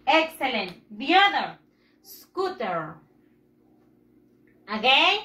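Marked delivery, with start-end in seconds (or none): 2.0-3.0 SCOOTER with the last syllable drawn out and dropping low; little tune at the end. Shown as a demonstration. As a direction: model SCOOTER for repeating, pitch sliding down on "-ter". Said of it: "Ese tonito al final".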